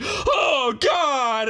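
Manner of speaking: high alarmed shout; shouting plumber. Retold or imitated. Plumber hearing the rattling tub, high panicked shout. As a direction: yell it up high in comic panic.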